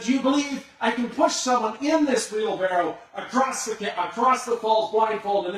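loud, high call across the falls; yelling to the crowd. Retold or imitated. Tightrope walker shouting to the crowd below, loud and high. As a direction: shout it so thousands can hear. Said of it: "And he said".

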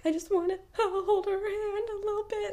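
high, whimpering imitation of a small child; mock-crying kid voice with a laugh in it; tearful little son. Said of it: "He was like".

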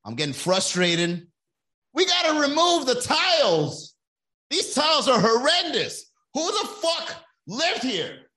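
loud, high mock fury; hammed-up rage at the tiles; angry renovator. Mocking an angry HGTV renovator: loud high rant. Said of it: "They're just angry".

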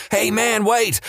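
high-pitched call, no real volume; mock yelling. A narrator's reading. Homeless man running up to brother-in-law, mildly yelled. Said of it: "running up to him yelling".

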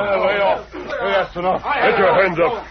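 high firm orders; commanding shout. Acted. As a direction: take control with a yell. Masked man ends the fight.

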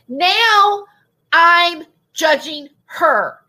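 "Now I'm judging her" drawn out, "Now" high; slow, deliberate punch on each word of "Now I'm judging her"; emphatic restating. Real, not acted. Telling viewers whom she judges, "Now I'm judging her" slow and emphatic.

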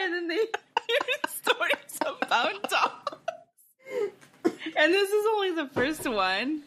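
laugh-crying. Real, not acted. Laughing while crying at story.

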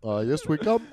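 put-on politician impression; Obama. Copying the co-host's president line, halting impression. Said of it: "Just Obama".

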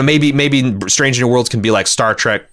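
ordinary talk, nothing marked

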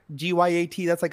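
usual speaking voice

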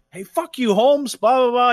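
upset driver. Retold or imitated. Other driver answering the insult, upset mock retort. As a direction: imitate a riled driver firing back. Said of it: "he starts getting all upset".

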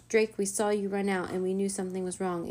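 usual speaking voice

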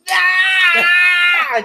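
long high loud scream; imitated yell. Man imitating the yelling guy from the gym, long loud high yell. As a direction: let out a drawn-out mimicked yell. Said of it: "he was fucking yelling, dude".

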